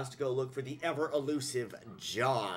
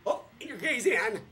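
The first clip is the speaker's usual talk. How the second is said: playful; 7 semitones higher, 5 dB louder than usual. Real, not acted. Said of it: "playful sign-off".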